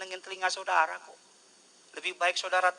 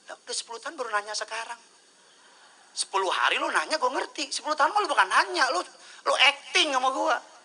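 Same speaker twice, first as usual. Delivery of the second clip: high, rising disbelief; mock exasperation; 9 semitones higher, 5 dB louder than usual. Real, not acted. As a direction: scoff at the silly question. Mock exasperation at a churchgoer's question. (pendeta kesal karena pertanyaan jemaat, nada tinggi mengejek)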